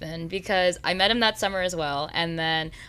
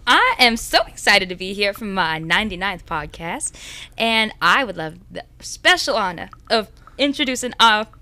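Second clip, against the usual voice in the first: put-on country accent; 3 semitones higher, 5 dB louder than usual. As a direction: greet the show like a bubbly cowgirl. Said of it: "You went from English to country".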